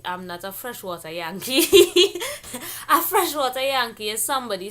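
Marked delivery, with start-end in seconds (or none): 1.7-2.8 big burst of laughter; loud laugh. Real, not acted. Laughs at own joke.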